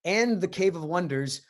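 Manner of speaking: ordinary delivery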